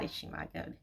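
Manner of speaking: breathless whisper, holding back tears; whispered to herself. Real, not acted. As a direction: whisper it to steady yourself. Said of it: "I'm not gonna get emotional".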